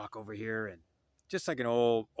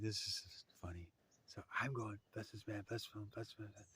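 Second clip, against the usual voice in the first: hushed whisper, much softer; 10 dB quieter than usual. Real, not acted. Narrator whispering the joke and her prayer to the listeners. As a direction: lean in and breathe it like a secret. Secret aside and silent prayer.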